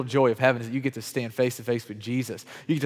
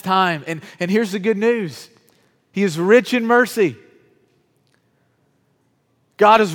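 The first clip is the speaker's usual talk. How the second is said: raised, loud, impassioned sermon delivery; full-force preaching; 6 semitones higher, 6 dB louder than usual. Real, not acted. Preacher urging doubters to believe God loves them, full-force preaching. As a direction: preach it with rising force, leaning on key words.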